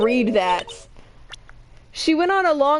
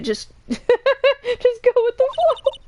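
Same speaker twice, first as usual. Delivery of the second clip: words breaking into high laughter; laughing; 10 semitones higher, much slower than usual. Real, not acted. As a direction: laugh your way through it. Laughs at own odd accent.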